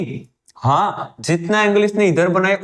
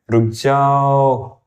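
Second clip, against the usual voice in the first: mock calling to stop; 3 semitones lower, much slower than usual. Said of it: "हम इसको ही रोकते हैं".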